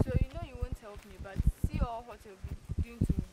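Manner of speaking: loud protest. Acted. Mistreated woman protests to the man.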